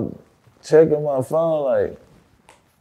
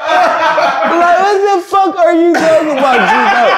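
high, laughing mock shout; cracking up while yelling at her; laughing incredulous yell; laughing disbelief; slower than usual. Laughing retelling reaction to partner.

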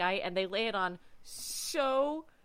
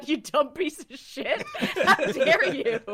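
slow, loud, hammed-up fury, higher; mock outrage; 6 semitones higher, 7 dB louder, slower than usual. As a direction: bellow it like a furious captain, laughing. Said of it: "And I wrote down".

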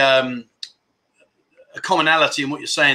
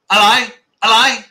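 calling out; 6 semitones higher, 5 dB louder than usual. Real, not acted. Calling to a quiet chat.

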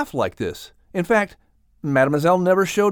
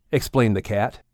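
ordinary delivery, nothing marked

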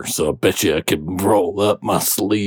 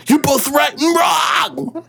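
hammed-up host verdict; slower than usual. Radio host verdict, high.

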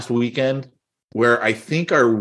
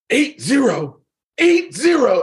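high-pitched outburst, climbing on the repeat; mock an outraged Russian dad, pitch shooting up; imitated Russian yelling; 12 semitones higher, 3 dB louder, slower than usual. Russian dad scolding his son after the lost game, mock yelling. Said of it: "So it's getting louder and louder".

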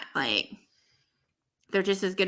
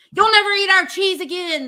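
higher, louder hammed-up threat; mock angry yell; 12 semitones higher, 7 dB louder than usual. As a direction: yell it like angry cheese fans. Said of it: "they'll yell".

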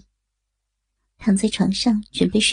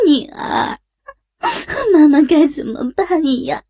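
crying harder; 6 semitones higher than usual. A narrator's reading. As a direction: plead helplessly through tears. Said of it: "李美玉的哭声越来越大".

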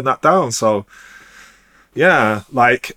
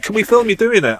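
high, eager put-on voice; mock filmmaker; 10 semitones higher, 4 dB louder than usual. Filmmakers asking to film him, high eager mock voice. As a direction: imitate the eager question. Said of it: "And I start doing stuff like".

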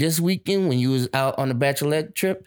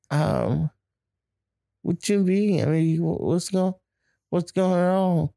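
put-on puzzled girlfriend, pitched higher; confused, pressing her for an answer; voicing the girlfriend; 5 semitones higher than usual. Girlfriend in the clip demanding to know what's going on, higher puzzled voice.

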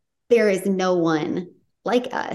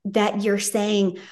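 ordinary talk

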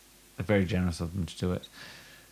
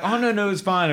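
breezy builder; 12 semitones higher than usual. Retold or imitated.

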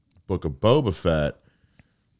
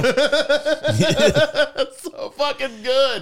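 high-pitched, helpless laughter through the words; real, drawn-out laughing praise; laughing hard; 3 dB louder, much slower than usual. Real, not acted. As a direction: crack up while praising the joke. Cracking up over a retold joke.

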